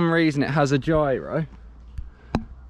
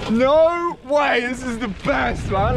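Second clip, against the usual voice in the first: thrilled yell; 8 semitones higher, slower than usual. Real, not acted. Thrilled during the downhill ride.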